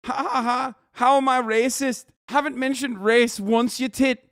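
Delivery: high, loud jeering English lad accent with a fake laugh; mock English lad. Quoting the wrestler's reply to Muhammad, in a mock English accent. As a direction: scoff at him in a hammed-up English lad accent.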